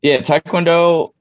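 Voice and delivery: ordinary talk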